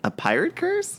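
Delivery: put-on high, playful pirate accent. Host teases co-host about the curse, mock pirate accent. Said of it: "where did this accent come from".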